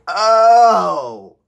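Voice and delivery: loud, high, drawn-out oh; sudden lightbulb moment, voice swelling up; big theatrical "oh" of getting it; dawning realization. Acted.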